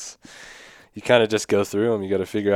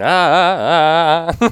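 dirt bike uphill; 7 semitones higher, 6 dB louder, slower than usual. Retold or imitated. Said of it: "going up the hill and".

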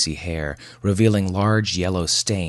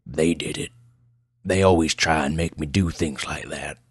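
put-on gruff old backwoods crank; gruff old man. Old patient blames "them" to the doctor, gruff. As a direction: grumble suspiciously like an old mountain man. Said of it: "Pleasanton's voice was as gruff as his appearance".

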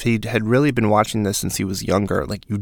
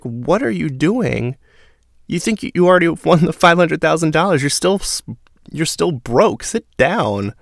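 higher-pitched mock telling-off; incredulous scolding; 7 semitones higher than usual. Houseguest in diary room about an overconfident newbie, mock incredulous scolding. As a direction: scold in high-pitched disbelief.

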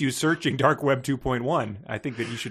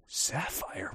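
hushed, incredulous echo of the name; mock disbelief; 3 semitones lower, 13 dB quieter than usual. Host mocking someone hung up on a stripper's name, mock disbelief. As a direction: repeat it softly as a baffled question. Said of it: "You wouldn't be like".

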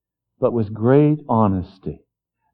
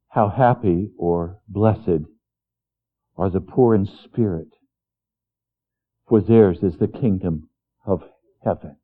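scripture reading; 5 semitones lower than usual. Acted. Reading a Beatitude.

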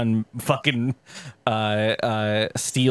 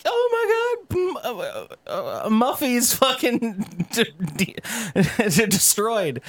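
hysterical family voice; 10 semitones higher, slower than usual. Retold or imitated. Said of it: "And they're like".

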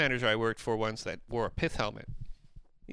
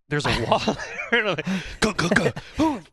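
louder, higher panicked yelling; flipping out; 9 semitones higher, 7 dB louder than usual. Retold or imitated. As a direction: wake up trapped and panic. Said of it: "And I start flipping out".

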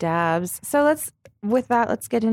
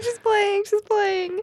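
excited; 11 semitones higher, slower than usual. Retold or imitated. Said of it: "We were like".

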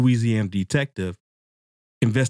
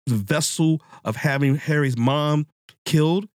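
normal talking voice

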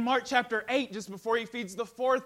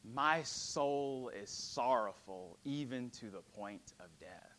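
slow, softer, lower sorrow; solemn quote; 9 semitones lower, 8 dB quieter, slower than usual. Acted. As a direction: speak it as a weary confession. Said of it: "Jesus tells his disciples".

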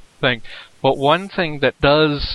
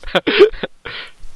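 high breathless laugh. Real, not acted. Laughing at a joke.

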